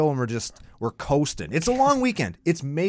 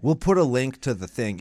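normal talking voice

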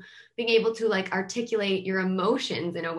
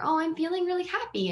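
put-on bright, much higher voice of a happy kid; happy kid answer; 10 semitones higher than usual. Therapist voicing a child who feels really happy, much higher and excited. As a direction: sound thrilled to share your day.

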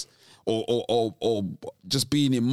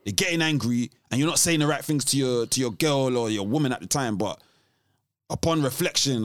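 usual speaking voice

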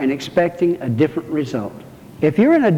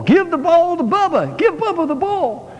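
much higher mock crowd chant; crowd yelling; 11 semitones higher than usual. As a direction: pitch it way up like a whole stadium demanding it. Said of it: "the crowd kept yelling".

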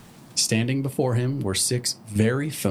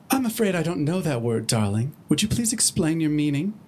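Rarity voice; 5 semitones higher than usual. Acted.

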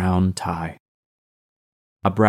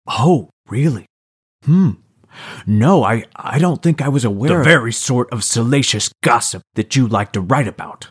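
mock concern, higher and louder; sarcastic; 4 semitones higher, 4 dB louder than usual. A narrator's reading. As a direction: fake surprise dripping with sarcasm. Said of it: "Max sarcastically faked a look of concern".

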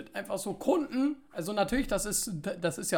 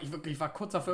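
ordinary delivery

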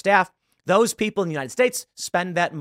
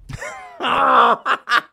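loud laugh; 6 semitones higher, 3 dB louder than usual. Real, not acted. Reacting to the played clip.

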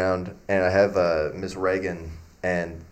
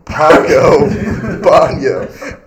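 loud laughing answer; 5 semitones higher, 13 dB louder, much slower than usual. Retold or imitated. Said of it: "And I was like".

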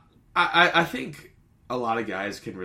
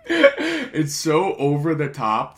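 loud, higher, slow words through laughter; laughing; 7 semitones higher, 9 dB louder, much slower than usual. Real, not acted. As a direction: gasp it out while cracking up. Still laughing about the scene.

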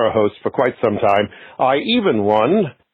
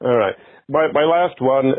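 usual speaking voice

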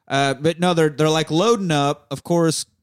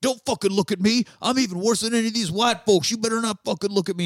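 higher, put-on voice barking at a slave; imitating Django snapping at a slave, pitched up; mock yelling overseer; 9 semitones higher, faster than usual. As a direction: play the cruel part, pitched up. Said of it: "he's yelling".

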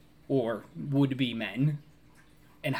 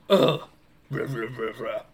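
sharp grunt into low grumbled ruh-ruhs; mock tough-guy grumbling; Vin Diesel grunt; 4 semitones lower than usual. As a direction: grunt and grumble like an action hero. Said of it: "Vin Diesel grunt".

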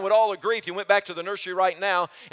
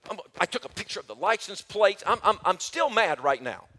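normal talking voice

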